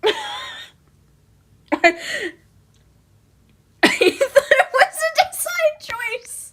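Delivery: words squeezed out through high laughter; laughing through words. Real, not acted. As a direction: say it while cracking up, slowly. Laughing at her own drawing.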